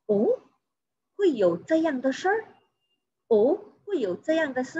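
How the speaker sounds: doubtful question in careful teaching Mandarin; somewhat suspicious. Shown as a demonstration. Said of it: "Dia menyatakan agak curiga". (agak curiga, bertanya dengan nada naik)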